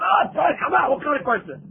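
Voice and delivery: mock impatient outburst; scoffing shout. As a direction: scoff at how dumb the question is. Students scoffing at the obvious question.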